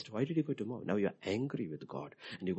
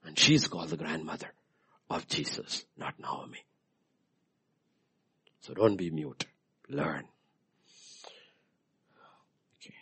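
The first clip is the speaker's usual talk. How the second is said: usual speaking voice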